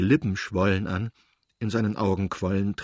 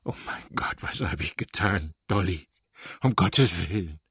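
low, breaking, despairing plea; choked by sobs; 3 semitones lower than usual. A narrator's reading. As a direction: sob caught in the throat. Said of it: "Schluchzen steckte ihm in der Kehle". (mit Schluchzen in der Kehle)

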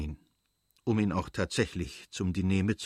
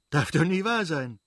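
excited disbelief; 7 semitones higher, 4 dB louder than usual. Acted. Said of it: "wiederholte er".

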